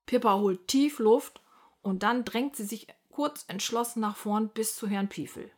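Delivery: usual speaking voice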